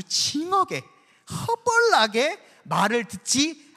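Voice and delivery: 전라도 사투리로 천천히 힘주어; Jeolla dialect. Shown as a demonstration. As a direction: lean hard into the Jeolla dialect, drawn out. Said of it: "사투리를 썼습니다".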